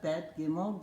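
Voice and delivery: normal talking voice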